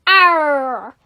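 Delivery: loud, long pirate "arr"; menacing pirate noise; pirate growl. Acted. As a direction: let out a big pirate arr. Pirate crew surrounding the enemy.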